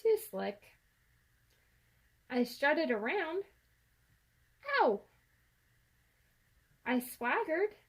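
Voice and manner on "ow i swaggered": ordinary delivery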